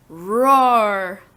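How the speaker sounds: loud, swelling, drawn-out roar; tiger roar. Shown as a demonstration. Teacher demonstrating animal sounds, loud tiger roar. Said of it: "Animal sounds".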